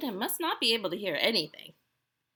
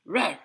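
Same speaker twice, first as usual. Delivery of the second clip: short, loud parting roar; bear growl; 6 dB louder than usual. A narrator's reading. Old Bear shuffles to the door and growls goodbye. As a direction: give one brief bear growl. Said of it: "he growled".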